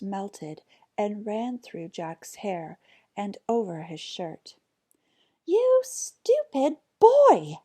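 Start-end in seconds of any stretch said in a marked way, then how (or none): 5.5-7.6 high, loud, slow shriek; a furious mother's drawn-out screech. Acted. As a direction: let the words shriek out at the son. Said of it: "shrieked his mother".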